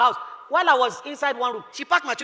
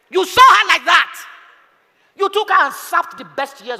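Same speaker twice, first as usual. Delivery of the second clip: impassioned preaching; 6 semitones higher, 4 dB louder, slower than usual. Acted. Raised preaching.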